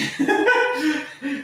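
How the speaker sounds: short high laugh; amused laugh. Real, not acted. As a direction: laugh at your own wording. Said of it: "that sounds dodgy".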